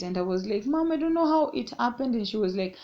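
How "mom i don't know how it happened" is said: anxious plea. Retold or imitated. Pleading with angry mom.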